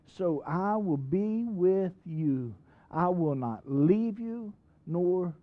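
drawn-out, weighty scripture reading; slow solemn reading. Acted. Preacher reading the promise to the church, slow and solemn. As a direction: give each word of the promise its time.

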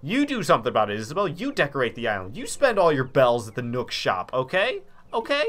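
loud annoyed complaining; aggressive. Real, not acted. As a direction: snap back at the assistant. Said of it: "I'm not supposed to be this aggressive".

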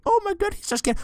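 high mock-girly voice mocking the scared streamer; mocking Jane. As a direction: tease like a laughing viewer. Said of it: "being like".

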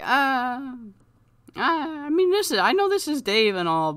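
high, hesitant hedging; uneasy crowd. Acted. Fans reacting to the beatdown, awkwardly reluctant.

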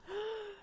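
high, soft gasp; small intake of awe; delighted gasp. Real, not acted. Creator seeing the headset, delighted gasp.

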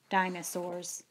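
usual speaking voice

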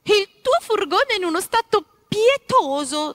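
high, loud scolding wife; wife scolding about the dirty van; woman's voice. Acted. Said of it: "disse ancora la voce femminile".

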